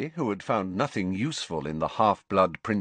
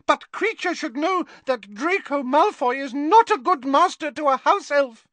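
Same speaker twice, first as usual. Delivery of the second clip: defiant; 5 dB louder than usual. A narrator's reading. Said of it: "said defiantly to Creature".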